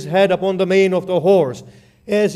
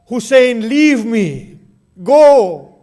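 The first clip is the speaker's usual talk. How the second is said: anguished cry; 4 semitones higher, 5 dB louder, slower than usual. Acted. Dying Abbas sends Hussein away.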